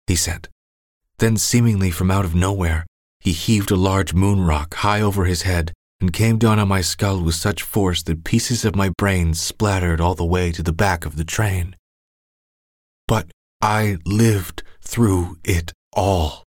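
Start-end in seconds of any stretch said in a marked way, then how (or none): none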